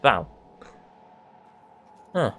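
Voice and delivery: ordinary talk, nothing marked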